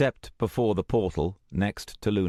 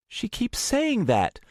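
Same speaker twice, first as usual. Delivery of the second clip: higher, slow puzzled voice; puzzled young woman; slower than usual. Acted. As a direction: ask in puzzlement. Luna puzzled.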